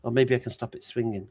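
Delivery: normal talking voice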